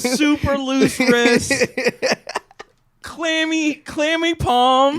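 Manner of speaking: high, slow mocking voice. Describing limp dap mockingly.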